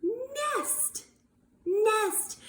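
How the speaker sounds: nest sung out high and bright; spring the answer up high; excited reveal. Shown as a demonstration. Teacher to class, a high excited nest. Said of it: "it also starts with".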